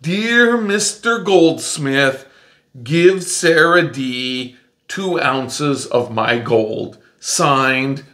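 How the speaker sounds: very slow, word-by-word dictation; writing aloud. Pretend. Teacher pretends to write the goldsmith a note, slowly writing aloud. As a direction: spell it out slowly as if penning a note. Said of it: "here's a note that I'm gonna write".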